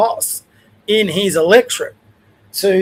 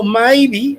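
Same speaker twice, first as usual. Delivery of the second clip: maybe said higher with a rising tune; emphasised maybe; 4 semitones higher than usual. Real, not acted. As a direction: lean hard on maybe and let it rise, doubtful. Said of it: "I emphasise the word maybe".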